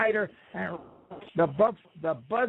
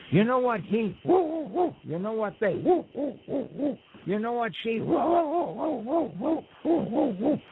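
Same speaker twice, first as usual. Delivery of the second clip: high yipping woo woo, voice climbing up; gossiping dogs; 6 semitones higher than usual. Acted. Dogs gossiping about the people, yipping high woo woos. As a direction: gossip that slides into dog howls.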